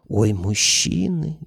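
slow, scornful drawn-out sigh; scornful drawl. Acted. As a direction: drag it out with contempt. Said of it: "презрительно протянула Маша". (презрительно протянуть)

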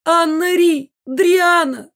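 very high, loud searching cries; mother calling daughters. A narrator's reading. Mother runs past calling her daughters, high loud cries. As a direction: call each name out shrilly. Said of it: "звала мама".

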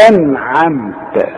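"أنعمت" slow, ending in clear "ta"; precise articulation. Shown as a demonstration. Teacher wants precise articulation, slow "أنعمت" ending in "ta". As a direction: sound out "أنعمت" precisely, slowly. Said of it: "تكون دقيق في إخراج هذه".

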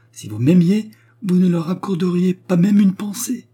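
high, loud, hurt insistence; heated lover's accusation, pitched well up; impassioned reproach. A narrator's reading.

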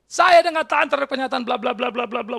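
high wail running on and tailing down into babble; mock loud complaint. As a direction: imitate a counselee ranting on and on. Retold counselee's loud complaint. (keluhan keras yang ditirukan)

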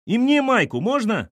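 put-on high, loud child's call; calling from above. A narrator's reading. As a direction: shout it down to them, pitched high. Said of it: "крикнул сверху Тим". (крикнув сверху, высоко и громко)

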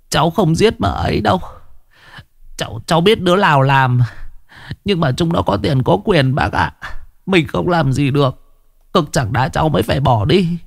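high, fast pleading; anxious young man. A narrator's reading. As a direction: protest innocence, frightened. Tuất pleading innocence. (giọng thanh niên van xin sợ hãi)

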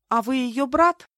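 high, polite rising question; courteous. A narrator's reading. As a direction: ask with obliging politeness. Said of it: "любезно уточнила я". (любезно, вежливо)

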